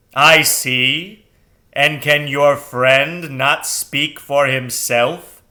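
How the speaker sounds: loud, slow owl voice; booming owl, slow; sonorous owl. Acted. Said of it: "The sonorous voice".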